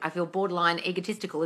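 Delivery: normal talking voice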